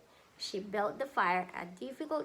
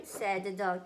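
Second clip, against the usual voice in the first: ordinary delivery, nothing marked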